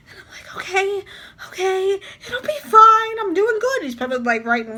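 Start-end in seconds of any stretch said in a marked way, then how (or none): 0.6-3.8 high, rising mock-panicky pep talk; panicked self-reassurance. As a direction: talk yourself down, near tears, exaggerated. Nervously reassuring herself while being graded.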